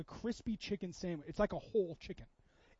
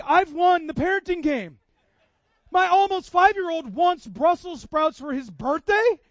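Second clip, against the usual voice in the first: louder, higher, gloating over a parenting win; thrilled triumph; 8 semitones higher, 15 dB louder, slower than usual. Retold or imitated. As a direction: crow it out, delighted with yourself. Dad thrilled son wants sprouts.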